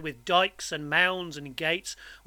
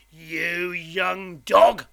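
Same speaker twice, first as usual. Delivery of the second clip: slow, drawn-out, loud relish; menacing convict; 7 dB louder, much slower than usual. A narrator's reading. Convict licking his lips at boy, menacing. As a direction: savour it as a threat.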